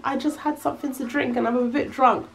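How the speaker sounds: soft, meek admission; sheepish confession. Retold or imitated. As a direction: own up timidly to grandma. Confessing to grandma.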